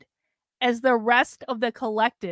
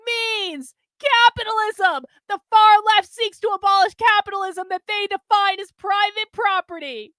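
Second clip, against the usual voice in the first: fervent recital; 8 semitones higher, 4 dB louder than usual. Acted. James recites the creed to Carlin, fervent. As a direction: recite it like a convert.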